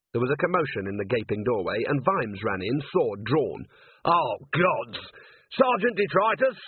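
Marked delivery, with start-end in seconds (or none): 4.0-6.6 calling out. A narrator's reading. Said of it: "Vimes calling".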